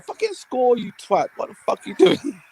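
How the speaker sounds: high furious yell at the pitch; berating a striker who won't score; hammed-up fury; ranting fan. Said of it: "I'm sitting there going".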